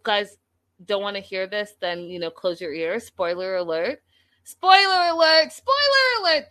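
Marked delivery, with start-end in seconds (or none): 4.4-5.5 "Spoiler alert" squeaked much higher and louder; high squeaky spoiler alert. Acted. Host warning chat of spoilers, high teasing squeal. 5.5-6.5 spoiler alert rising much higher and louder, sing-song. Acted. Host teasing chat before revealing the wedding, high sing-song squeal. Playful spoiler warning repeated.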